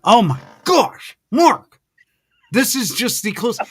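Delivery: high, loud fan gushing; starstruck excitement. Real, not acted. Fan host gushing to his museum guest, starstruck and excited.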